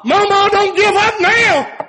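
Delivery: high, loud cry of "Mama, don't give up now"; high shouted pulpit plea. Acted. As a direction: call it out to the mothers, pleading. Repeated plea, shouted higher.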